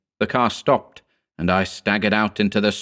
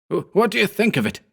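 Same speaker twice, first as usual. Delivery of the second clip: high, fast, stammering with glee; exultant; 12 semitones higher, faster than usual. A narrator's reading. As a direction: burst out in triumph. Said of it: "he cried exultantly".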